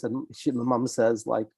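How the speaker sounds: ordinary talk, nothing marked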